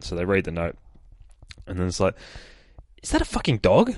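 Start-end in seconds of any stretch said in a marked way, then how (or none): 3.0-3.9 put-on much higher, disbelieving kid's question; amazed kid. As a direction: squeak it out in shock. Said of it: "says the other kid who's peeking".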